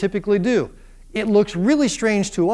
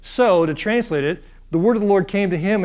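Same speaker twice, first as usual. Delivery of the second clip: ordinary delivery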